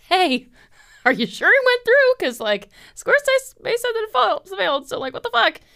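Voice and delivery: high, loud pretend call, laughing; hammed-up puzzled complaint; mock incredulous. She rehearses calling her bank, mock incredulous. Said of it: "call the bank and be like".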